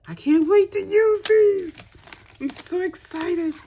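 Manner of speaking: high, slow, thrilled squeal; excited squeal. Real, not acted. Crafter squealing to viewers over new dies, excited. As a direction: gush over the new stamps.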